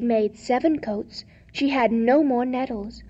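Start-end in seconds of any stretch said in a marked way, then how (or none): none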